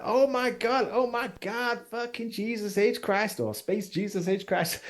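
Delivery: overjoyed, can't believe it's them; couple's excited disbelief. Acted. The couple greet the players' crew, high and gushing. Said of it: "And they're like".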